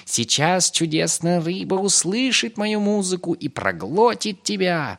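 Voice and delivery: high, loud, drawn-out threat; menacing rat. A narrator's reading. Rat with a pipe threatens Pip, menacing. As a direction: gloat loudly in a high creature voice.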